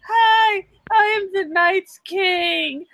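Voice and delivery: put-on high, slow girly voice; little girl voice. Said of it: "sounds like a little girl".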